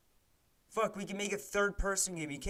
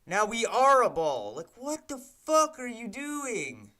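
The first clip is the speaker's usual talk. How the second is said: exasperated outburst; 6 semitones higher, 6 dB louder than usual. Real, not acted. Streamer shouting at the bouncing ball, exasperated. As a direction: stretch it out in disbelief.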